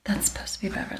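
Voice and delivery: unvoiced whispered aside. Real, not acted. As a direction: breathe it under your breath.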